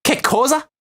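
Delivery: high, hammed-up Italian "Che cosa?"; a cartoon Italian, playfully; mock Italian. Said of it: "I sound Italian?".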